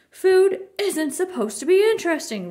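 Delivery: loud, high bellow from Papa; roaring. A narrator's reading. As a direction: roar it across the dinner table. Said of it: "roared Papa".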